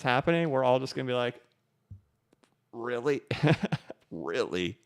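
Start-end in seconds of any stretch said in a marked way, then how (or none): none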